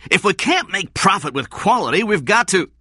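high, loud, angry protest; heated and insistent. A narrator's reading. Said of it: "Blake said angrily".

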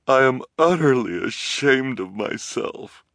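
tearful, broken admission; crying confession; through tears. A narrator's reading. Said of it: "through her tears".

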